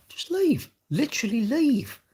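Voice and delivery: very high, exasperated insistence; incredulous, insistent plea; emphatic urging. Real, not acted. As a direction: push the point with pitch way up. Urging unhappy workers to quit.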